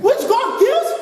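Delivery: high, loud indignant question; indignant outcry. Retold or imitated. Preacher imitates a shocked listener, high loud mock outrage. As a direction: fire back in mock outrage. Congregation member protesting, retold.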